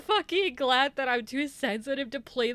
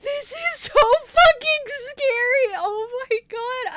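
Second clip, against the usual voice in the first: frightened near-sobbing cry, much higher and louder; scared and tearful; 8 semitones higher, 10 dB louder than usual. Real, not acted. Player terrified by the horror route, crying out in fear. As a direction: wail it out, close to tears from fear. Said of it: "I have tears in my eyes".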